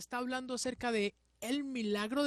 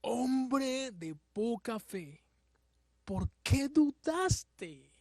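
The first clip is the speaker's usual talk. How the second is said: slow, drawn-out gentle rebuke; deliberate, solemn acted question; Jesus; much slower than usual. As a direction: stretch each phrase in measured reproach. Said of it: "y le dijo".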